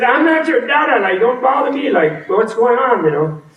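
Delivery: put-on high, flustered god brushing kids off; flustered refusal. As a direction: push them away in a panic. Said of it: "So first I said".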